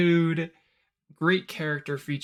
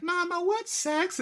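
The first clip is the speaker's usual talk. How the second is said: put-on high, childlike voice of a five-year-old; a small boy asks his mom in the cinema, high and innocent; little kid asking. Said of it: "turn to his mom and be like".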